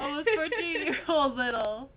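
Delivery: loud, laughing repeat; cracking up mid-story. Real, not acted. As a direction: say it loudly through laughter. Laughing as they tell story.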